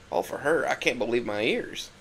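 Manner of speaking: usual speaking voice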